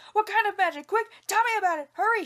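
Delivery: high, loud and fast shout. A narrator's reading. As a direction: demand it at full volume, rushing. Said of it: "shouted Li Tong".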